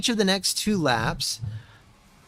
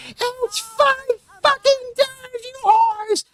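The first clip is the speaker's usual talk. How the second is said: silly falsetto; 3 dB louder, slower than usual. Pretend. Sudden falsetto outburst while racing.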